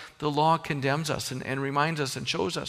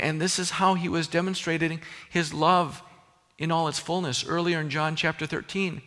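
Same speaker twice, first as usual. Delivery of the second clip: ordinary talk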